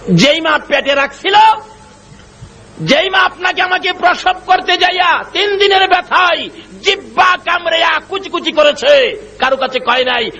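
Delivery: high, forceful sermon cry; impassioned preaching. Acted. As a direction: preach the mother's suffering at a high pitch. (চড়া গলায় আবেগী ওয়াজ)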